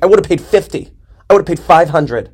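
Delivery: high, loud, giddy overstatement; giddy exaggeration. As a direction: gush like a smitten customer. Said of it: "I'm like".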